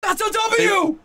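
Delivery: high, thrilled congratulation; excited cheer. Real, not acted. Hearing friend is paid to go, excited cheer. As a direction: celebrate it in a high voice.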